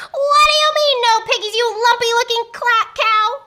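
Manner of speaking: high, loud witch scream; shrieked, indignant tirade; furious scream. A narrator's reading. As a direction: scream your outrage at the culprit. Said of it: "screamed Gritch".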